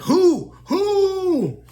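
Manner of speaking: sudden high falsetto questions; startled Sammy. Retold or imitated. Sammy spooked in the woods, squeaking high. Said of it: "So Sammy's just like".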